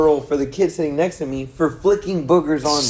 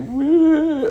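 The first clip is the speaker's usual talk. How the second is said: high, drawn-out mock sob; mock crying wail; 4 dB louder than usual. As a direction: imitate her bawling in one long wail. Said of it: "like, really crying".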